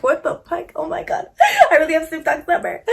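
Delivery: laughing in stunned excitement; giddy disbelief. Retold or imitated. Disbelief at getting [name]'s number.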